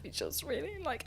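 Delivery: put-on quiet child voice. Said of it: "And she said".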